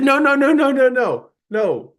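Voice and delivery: high, loud, hurried no's; frantic refusal. Retold or imitated. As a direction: quickly wave off the offer to lock it. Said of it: "I said".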